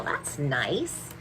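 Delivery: plain reading voice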